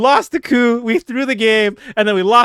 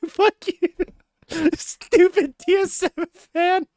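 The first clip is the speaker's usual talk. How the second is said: laughing taunt; 12 semitones higher, much slower than usual. Retold or imitated. Fan mocking rival friends, laughing and much higher. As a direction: gloat through laughter. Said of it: "I was like".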